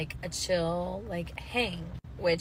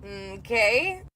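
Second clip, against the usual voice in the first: a drawn-out, doubtful okay with a rising end; voicing her own disbelief at his microwaved patties; unimpressed okay; 3 semitones higher, 6 dB louder than usual. Narrator mimics her face on the date, skeptical rising okay. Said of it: "And my face is like".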